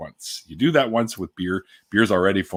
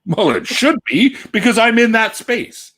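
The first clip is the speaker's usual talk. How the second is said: mock indignant; 13 semitones higher, 5 dB louder, slower than usual. Joking with store clerk.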